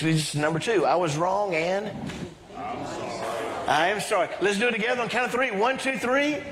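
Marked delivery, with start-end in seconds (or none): none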